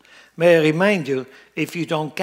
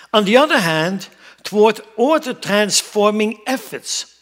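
loud, raised preacher's reading voice; emphatic sermon reading; 6 semitones higher, 8 dB louder than usual. Acted. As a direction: proclaim the written passage to the congregation. Reads a quotation out in preaching.

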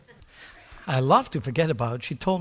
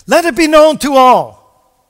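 loud, slow, higher proclamation; Peter's bold proclamation; 10 semitones higher, 15 dB louder, slower than usual. Acted. Peter proclaiming before the council, loud and slow. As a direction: declare it boldly to the whole crowd. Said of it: "he said".

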